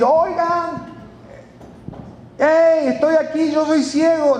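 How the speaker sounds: acted shout for attention, pitched well up; imitated calling out. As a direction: hail passers-by like the blind man. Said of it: "Su grito iba dirigido".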